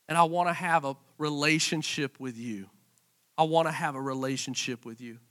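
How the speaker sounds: whisper-close heartfelt invitation; God inviting the listener, softly. Acted. God's invitation, dropped soft.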